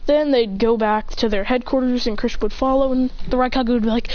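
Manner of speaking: ordinary delivery, nothing marked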